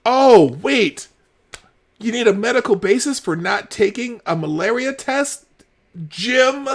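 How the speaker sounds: high, sarcastic questioning; mock realization. Real, not acted. Host needling Jim about his medical basis, sarcastic. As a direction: feign a sudden discovery, then needle him. Mocking the journalist's logic.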